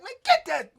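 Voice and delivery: barked order. Retold or imitated. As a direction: yell it to send him back in. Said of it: "Spoon like".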